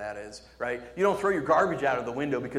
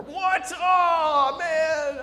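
high, loud whining protest; mock outrage; 8 dB louder, much slower than usual. Preacher playing a teen banned from hamsters, mock outrage. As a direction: wail in exaggerated disbelief.

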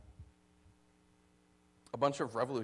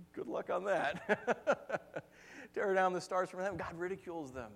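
ordinary talk, nothing marked

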